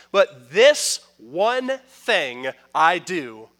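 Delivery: slow, deliberate reading of the verse; scripture reading. Acted. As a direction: read it out slowly like holy text. Reads scripture slowly.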